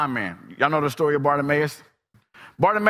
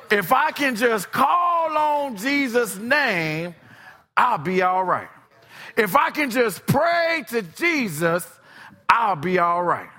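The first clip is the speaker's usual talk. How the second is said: high loud cry of hope; desperate shout; 8 semitones higher, 3 dB louder, slower than usual. Acted. Blind Bartimaeus crying out for Jesus, loud and high. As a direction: shout it out, reaching for help.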